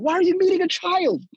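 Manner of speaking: high-pitched, quickened disbelief; incredulous. Real, not acted. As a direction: question it in baffled disbelief. Incredulous about the married man.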